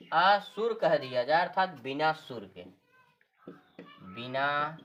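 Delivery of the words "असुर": असुर said in two parts, drawn-out अ, then सुर; split word. Shown as a demonstration. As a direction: break असुर after a lengthened अ. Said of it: "अ को अलग करके बोल दिया जाए". (असुर को "अ... सुर" तोड़कर बोलें)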